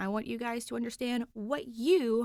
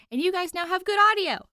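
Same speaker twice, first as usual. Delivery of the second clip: much higher, louder happy burst; excited; 6 semitones higher, 8 dB louder, faster than usual. Real, not acted. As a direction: gush with excitement. Happy about her fixed audio.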